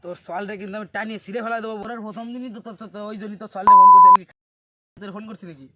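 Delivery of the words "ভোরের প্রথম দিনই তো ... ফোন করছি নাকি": ordinary delivery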